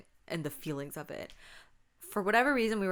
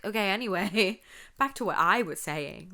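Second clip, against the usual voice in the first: breezy move on; 2 semitones higher, 6 dB louder than usual. Retold or imitated. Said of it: "I'm like".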